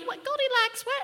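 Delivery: high-pitched, startled questioning; mock bewilderment. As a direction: squeak out your disbelief, acted. Acts out reaction to nickname.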